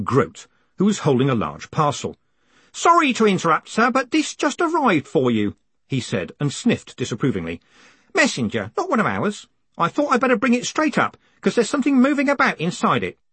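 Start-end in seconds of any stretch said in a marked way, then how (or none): 2.7-5.6 high-pitched apologetic underling; high character voice, politely butting in; old postal worker character. Acted. Groat interrupts with a parcel, acted. 8.1-13.2 high-pitched, fussy subordinate reporting to the boss; high, put-on voice of a put-out messenger; disapproving underling. Groat's voice in the story.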